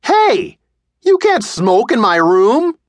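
put-on high, loud, slow boy's protest; boy shouting at the smoking stranger. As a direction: yell it, shocked at the smoking. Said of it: "boy protests the smoking".

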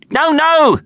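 very high, loud yelp. A narrator's reading. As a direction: cut in with a high-pitched yelp. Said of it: "she yelped".

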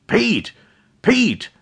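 high, desperate shout into phone. Acted. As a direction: yell his name in alarm. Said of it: "Jack shouted into the phone".

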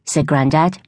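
usual speaking voice

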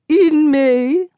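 a quick mock British accent, tossed off high; mimicked British accent. Charlotte teasing Evelyn, repeating her words in mock British accent. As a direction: parrot the phrase back in a teasing British accent. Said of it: "Charlotte mimicked in a British accent".

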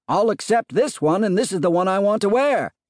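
high-pitched, insistent holler; shouting down. A narrator's reading. Husband complaining to wife about his scarf, shouting down. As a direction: argue it from the top of the house.

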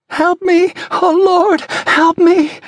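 screamed high, loud desperate cry for help; terrified plea. Acted. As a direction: beg for your life through the glass. Stranger begging at car window.

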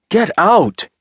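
high loud furious scream. A narrator's reading. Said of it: "he screamed".

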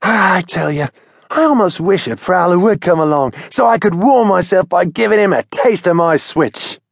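put-on high young bird boasting; Billy boasting to Henry, in a high bird voice; Billy's voice. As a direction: brag about fighting prowlers, high-voiced. Billy boasts about prowlers.

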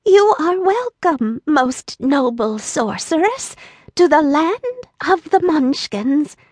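put-on high, sweet little old woman voice. A narrator's reading. Little old woman welcoming Dorothy, sweet and high. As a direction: greet her kindly as a tiny old lady. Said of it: "said in a sweet voice".

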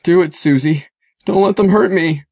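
high-pitched, frightened pleading; beg, holding back tears; choking back a sob. A narrator's reading. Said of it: "Zeke choked back a sob".

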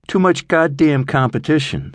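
high-pitched grumble; grumbling. A narrator's reading. Sal on the failing Miami business, grumbling. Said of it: "Sal grumbled".

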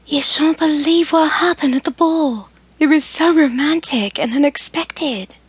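put-on a gentle older sister checking in at night; Amelia, elder sister. A narrator's reading. Reads Amelia's lines.

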